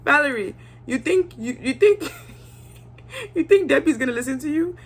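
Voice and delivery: high, laughing disbelief; incredulous mock. Speaker to Valerie, mocking her hopes, high and amused.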